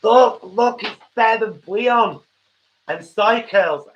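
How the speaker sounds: high, loud put-on American accent. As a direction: play an excited American showing off a product. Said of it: "with an American accent now".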